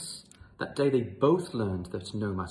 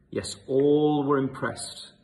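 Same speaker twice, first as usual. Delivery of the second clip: slow and loud, booming; grand; 7 dB louder, slower than usual. A narrator's reading. Narrator praising the mighty lion, loud and slow. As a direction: boom it like a proud announcement.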